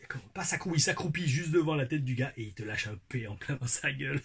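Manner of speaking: normal talking voice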